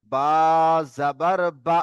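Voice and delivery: name the letter, then a short final "বা"; short unstretched vowel. Shown as a demonstration. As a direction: cut the last "বা" short, no drawl. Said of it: "টান দিলাম না". (শেষের "বা" টান ছাড়া)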